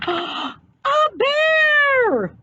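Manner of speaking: very high startled shriek; shocked cry. A narrator's reading. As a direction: shriek at the sight of the bear. Mom sees the bear.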